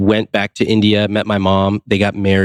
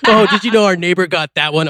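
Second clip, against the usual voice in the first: mock Indian parents; 8 semitones higher than usual. Parents voiced in a story.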